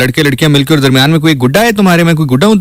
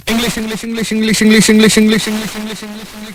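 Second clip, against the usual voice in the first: rattled-off gibberish English, higher; mock fast English; 5 semitones higher, 8 dB quieter than usual. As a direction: babble the word English as if speaking fast. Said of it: "तेज-तेज English बोलूंगा".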